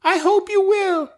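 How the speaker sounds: very high, thin woman's voice; high squeaky voice. Acted. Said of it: "is this your Mexican accent".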